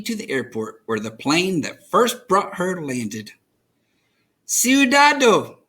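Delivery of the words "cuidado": higher, louder, slow careful warning. A narrator's reading. Abuela warning Rosa to be careful, higher and slow. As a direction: warn the child gently but firmly. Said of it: "Abuela would tell me".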